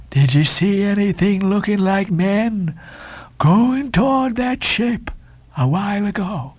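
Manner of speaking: high, slow, eerie questioning; Elijah's voice. A narrator's reading. Elijah with a hand on the shoulder, slow high question. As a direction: draw out each phrase as a strange prophet.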